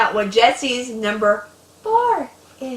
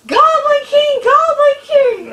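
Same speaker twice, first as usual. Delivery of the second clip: much higher and louder mock yell; imitated yell; 10 dB louder, slower than usual. Big sister yelling downstairs to frighten little sibling, mock yell. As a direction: bellow it down the stairs to scare the kid. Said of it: "she would yell".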